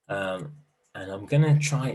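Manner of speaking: normal talking voice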